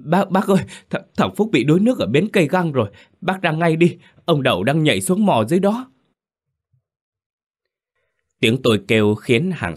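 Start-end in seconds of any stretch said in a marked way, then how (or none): 0.0-5.9 high, stammered, hurried terror; boy tells the father his son drowned, scared stammer; stammering in fear. A narrator's reading. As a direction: get the words out through shock. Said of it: "mãi mới thốt lên lời".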